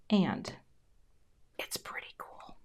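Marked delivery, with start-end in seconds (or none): 1.6-2.6 very soft whisper while concentrating; real involuntary whisper. Real, not acted. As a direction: whisper under your breath as you work. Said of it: "Sometimes I whisper when I concentrate".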